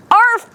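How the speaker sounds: high, loud little arf; sudden high yelp of a bark; dog bark. A narrator's reading. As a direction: bark like a puppy. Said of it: "George went".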